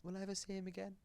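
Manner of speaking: high, small pleading voice; sad child. Acted. Little pup asking mum about lost friend, high small voice. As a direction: ask it high, like a little kid. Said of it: "she asks the mum".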